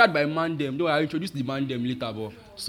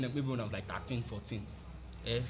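normal talking voice

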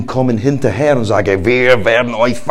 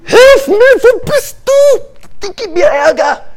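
much higher, loud desperate wail; panicked cry to God; 4 dB louder, slower than usual. Acted. Moses turning to God, high panicked wail. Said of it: "Und er hat sich umgedreht".

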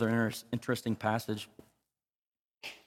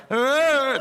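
mock hesitant uh; 10 semitones higher, 6 dB louder than usual. Mock reluctant reply to singer.

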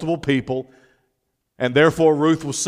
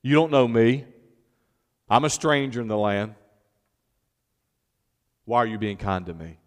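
soft, low, with long pauses; subdued question; 5 semitones lower, 7 dB quieter than usual. Retold or imitated. Preacher voices Ruth the stranger asking Boaz, subdued. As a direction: let the retold question sink quieter and lower.

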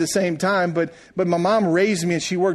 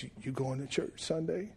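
faint mumble; 14 dB quieter than usual. Retold or imitated. Dying mother mumbling in hospital.

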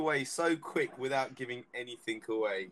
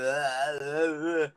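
dazed moaning; 4 dB louder, much slower than usual. Retold or imitated. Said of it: "you're sitting there going".

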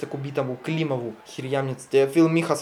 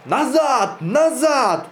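very high, loud warning cries; Porfiry shouting the workers back, loud warning; 11 semitones higher, 6 dB louder, much slower than usual. Acted. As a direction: yell them back from the gate. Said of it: "Порфирий кричит тем".

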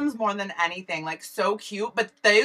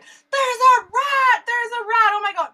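high, loud frantic screams of fright; retold panic scream at a rat; panicked screaming; 13 semitones higher, 5 dB louder than usual. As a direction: shriek it like a rat just ran past your feet. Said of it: "Like, I was screaming".

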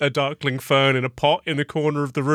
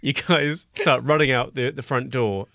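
ordinary delivery

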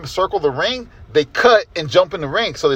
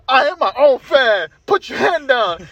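higher, slow, grand boast; pompous heel; 7 semitones higher, slower than usual. Retold or imitated. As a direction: stretch it out like a self-important villain. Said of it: "he was like".

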